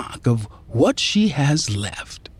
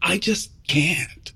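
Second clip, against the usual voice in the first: weary; 6 semitones higher, 4 dB quieter, slower than usual. Acted. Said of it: "she says wearily".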